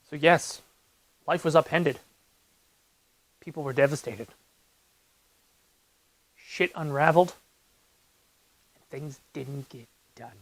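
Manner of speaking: fading into a hushed, grave delivery; mock somber. Mock-solemn account of his excuse.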